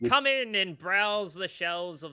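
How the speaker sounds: hearty shopkeeper pitch; gnome shopkeeper. Acted. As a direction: sell the shop like a carnival barker. Gnome shopkeeper invites them in.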